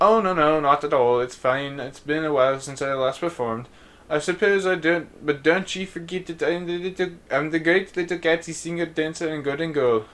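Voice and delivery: lightly put-on old English lady voice; a faded music hall star, half-hearted accent; a hint of a London showgirl; botched English accent. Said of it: "messing up these accents".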